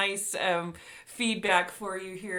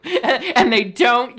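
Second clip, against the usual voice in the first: laughing; 3 semitones higher, 10 dB louder, slower than usual. Real, not acted. Host laughing while praising cousin.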